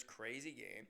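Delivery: ordinary talk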